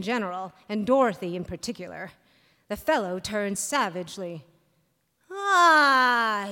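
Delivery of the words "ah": sneer. A narrator's reading. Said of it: "he sneered".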